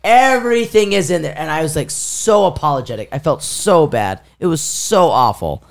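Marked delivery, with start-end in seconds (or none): 0.0-1.3 high, emphatic exclamation; raised, emphatic retold voice; excited African man. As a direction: imitate an African man overcome with relief. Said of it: "He had an African accent".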